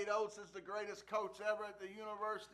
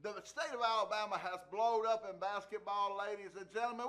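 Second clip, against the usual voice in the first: ordinary delivery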